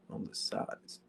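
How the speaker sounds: whispered "On the sides" under the breath. Real, not acted. A player muttering a skeptical echo of the flat-earth claim in a whisper. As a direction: breathe the words like an aside.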